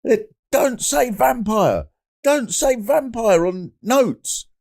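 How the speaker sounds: very high, slow doctor impression; high, drawn-out comic mimicry; imitated puzzled doctor. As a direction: read it off slowly in a high mock-doctor voice. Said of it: "he was looking up notes and he was going".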